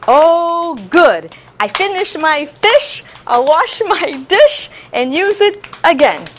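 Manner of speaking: put-on teacher chanting to clapping children; rhyme recital. Shown as a demonstration. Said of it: "reciting reuse rhyme".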